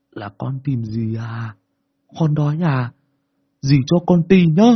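breathy ghost coaxing; ghost woman's wheezing voice. Acted. Boy imitates the ghost woman coaxing him, wheezing. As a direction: coax like a creepy ghost woman. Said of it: "thở khe khe còn hỏi cháu là".

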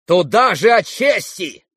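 loud, high, drawn-out jeer; spiteful cry. Acted. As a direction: cry it out with malice. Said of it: "крикнул он злобно".

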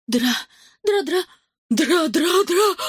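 high slow scared yelling; panicked yelling. Acted. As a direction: yell it stammering in panic. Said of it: "вопили селяне".

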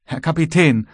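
high, distressed plea; sobbed out. A narrator's reading. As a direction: choke out an upset appeal to the captain. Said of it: "schluchzte der Kleine hervor". (schluchzend hervorgestoßen)

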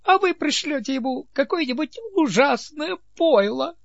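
high delighted conspirator; gleeful. A narrator's reading. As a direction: squeal with wicked delight. Ninet delighted by scheme.

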